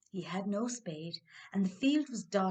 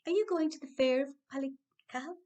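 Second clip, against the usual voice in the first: a lightly higher, merry little man; leprechaun voice; 6 semitones higher, 5 dB louder than usual. Acted. Leprechaun greeting Dan in a higher, friendly voice.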